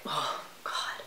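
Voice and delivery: breathless whispered oh God; whispered dread. Retold or imitated. Frightened whisper as neighbor says get in. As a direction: barely voice it, frightened. Neighbor urges her into car.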